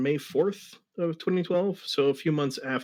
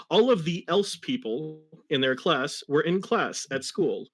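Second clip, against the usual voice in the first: ordinary delivery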